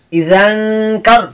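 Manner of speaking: ইজান ending in a long nasal "ng" hum before কার; hidden n, ghunnah. Shown as a demonstration. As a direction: recite ইজান কার as "izanng-kar", holding the hum. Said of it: "গুণনা করে পড়তে হবে".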